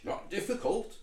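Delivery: high West Country toad voice; toad accent. Acted. Toad brushes off question about stage training, West Country accent. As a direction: answer breezily in broad West Country. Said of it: "he's talking foreign like".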